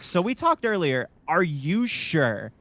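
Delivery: a mild, drawn-out are-you-serious; incredulous. Retold or imitated. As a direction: ask it like you can't believe him. Her incredulous reply on the phone.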